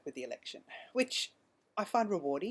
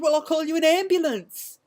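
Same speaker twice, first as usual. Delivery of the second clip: much higher and louder pushy offer; aggressive officer; 11 semitones higher, 13 dB louder than usual. Retold or imitated. Officer snapping at the panicking driver, high and loud. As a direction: make the offer sound like a threat. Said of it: "quite aggressive towards me".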